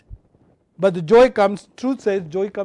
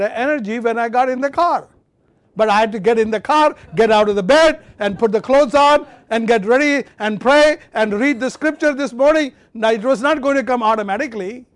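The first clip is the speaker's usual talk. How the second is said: fervent preaching; 4 semitones higher, 6 dB louder than usual. Real, not acted. Preaching at full force.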